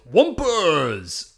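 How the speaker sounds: loud yell falling in pitch; mock yell. Podcaster imitates a villain yelling at the Rangers, mock yell. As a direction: holler it out in a funny drawn-out way. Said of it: "Zelmoda just yells".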